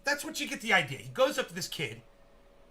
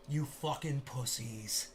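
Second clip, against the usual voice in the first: normal talking voice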